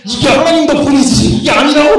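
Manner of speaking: loud, high mock indignation; hammed-up outraged objection; glaring protest. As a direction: imitate offended students arguing back. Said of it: "막 나를 째려!".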